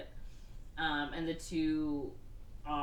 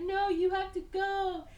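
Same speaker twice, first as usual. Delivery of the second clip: very high, slow imitation of a worried woman; mock pleading; 13 semitones higher than usual. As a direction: plead in a squeaky put-on voice. Doctor pleads, retold.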